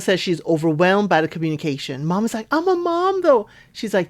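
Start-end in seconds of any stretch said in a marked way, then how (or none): 2.5-3.5 high-pitched mock protest; voicing the mom. Recapper mimics the mom reassuring her daughter, high-pitched protest.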